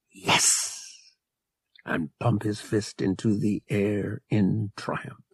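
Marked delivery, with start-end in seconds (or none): 0.1-0.5 triumphant whisper. A narrator's reading. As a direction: whisper the victory to yourself. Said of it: "Han allowed himself to whisper".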